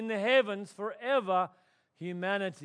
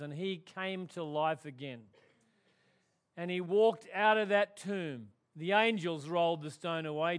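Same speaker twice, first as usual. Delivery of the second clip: ordinary delivery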